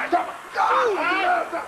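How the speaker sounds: high, forceful yells with each blow; drawn-out comic battle yell; furious shouting. Acted. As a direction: shout it as you strike.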